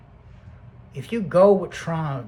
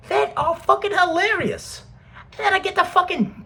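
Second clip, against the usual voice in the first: laughing; 6 dB louder than usual. Real, not acted. Laughing at hilarious things.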